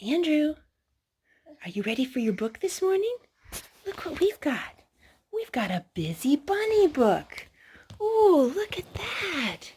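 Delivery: put-on gentle baby-talk lilt; talking to a child. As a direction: coax the child toward the book.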